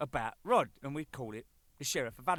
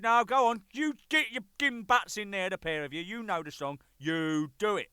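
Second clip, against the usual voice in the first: bossy bandmate; 7 semitones higher, 7 dB louder than usual. Retold or imitated. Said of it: "He said".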